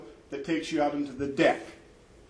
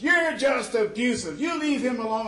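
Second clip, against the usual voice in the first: higher, louder, slowed accusation; angry accusing shout; 7 semitones higher, 3 dB louder than usual. Retold or imitated. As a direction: yell it at him like an attacker. [name] yells accusation.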